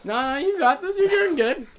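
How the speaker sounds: encouraging. Real, not acted. Cheering friend on.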